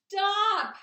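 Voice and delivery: very loud, high-pitched scream. A narrator's reading. Pig cutting off the sheep's grim account, screamed. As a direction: cut in with a panicked scream. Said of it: "screamed Wilbur".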